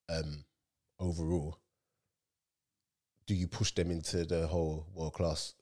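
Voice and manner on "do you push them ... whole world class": ordinary delivery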